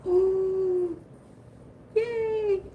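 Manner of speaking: high squeal of relief; relieved yay. Real, not acted. Hearing the card was found, high relieved squeal. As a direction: let out a happy relieved squeal.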